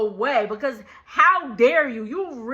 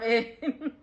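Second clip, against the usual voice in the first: ordinary delivery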